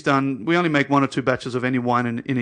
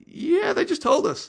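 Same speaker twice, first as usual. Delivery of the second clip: squeaky, much higher disbelief, slowed down; mock-shocked "yeah, they just told us"; incredulous protest; slower than usual. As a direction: play baffled innocence in a high pitch. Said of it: "And we're like".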